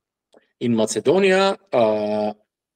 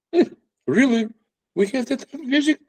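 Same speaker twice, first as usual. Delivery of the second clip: incredulous; 12 semitones higher, faster than usual. Retold or imitated. Guy doubts the Kosovo metal claim.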